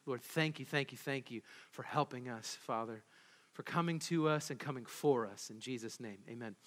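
soft, hushed prayer voice. Acted. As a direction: pray quietly and reverently. Opening prayer.

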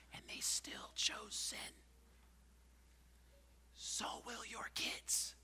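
whispered, grave warning; slow dramatic whisper; hushed whisper. Acted. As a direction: drop to a whisper to land it. Sermon climax hushed.

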